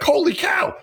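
high, astonished exclamation; holy cow, struck by surprise; mock amazement. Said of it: "it's like".

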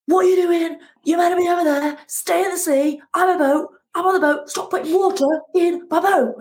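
hammed-up angry shout at the waves; angry shout at the sea. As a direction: scream at the sea like a raging tantrum. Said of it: "shouting every sort of angry thing".

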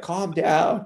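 imitated dad trying to settle a fight, pitched high; old dad. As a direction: play an older dad breaking up trouble. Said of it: "He's, like, old".

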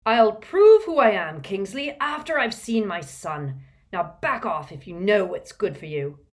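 loud, forceful fatherly outburst; angry shout. A narrator's reading. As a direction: shout down whoever stands in your way. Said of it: "never heard Mister Weasley shout like that".

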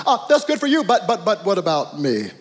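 mock a self-centred whiner butting in; hammed-up me-me-me interruption; "but me" person. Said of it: "I call them "but me" people".

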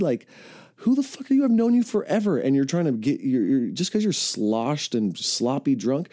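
high-pitched mocking shout back at the drunk; defiant yell. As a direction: yell it high and cocky, telling him off. Retold defiant comeback to bully.

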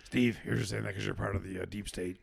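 low gruff conspiracy ranting voice; Alex Jones impression. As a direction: growl it like a paranoid radio host. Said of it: "you derailed my impression".

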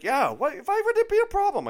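very high, loud mock German accent; German realtor. German realtor brushing off the client's worry, loud high imitated accent. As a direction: imitate an incredulous German woman, shooting up in pitch. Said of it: "tell by the accent she's obviously from Germany".

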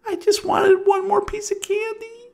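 put-on very high small child voice; little girl. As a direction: whine like a little kid.

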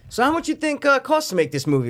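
high interviewer question. Retold or imitated. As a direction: ask it like a reporter. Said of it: "someone's asking Anakin".

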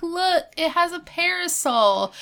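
high, delighted pitch; excited Topanga. Retold or imitated. Bride proudly points out the parasol, high and delighted. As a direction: show off the parasol gleefully. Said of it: "She's like".

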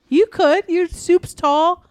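high, slow goofy voice; high silly voice. Pretend. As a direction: squeak it out slowly like a joke. Joking high voice.

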